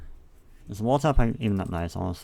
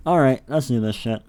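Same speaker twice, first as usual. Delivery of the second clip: pumped up; 5 semitones higher, 9 dB louder than usual. Real, not acted. Pumping up to start building.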